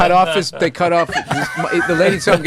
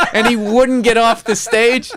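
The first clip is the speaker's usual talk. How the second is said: usual speaking voice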